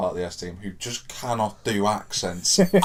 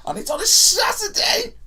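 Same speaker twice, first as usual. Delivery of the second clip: much higher, bright announcement; excited; 5 dB louder than usual. Real, not acted. Host cheering that the show is on a Saturday, excited. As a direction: sell it like big news. Host hyping the weekend episode.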